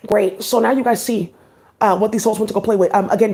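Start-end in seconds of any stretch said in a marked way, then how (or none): none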